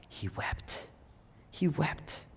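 hushed, tender whisper. Real, not acted. Preacher whispering that Jesus wept. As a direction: barely breathe it.

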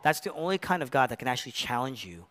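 ordinary delivery

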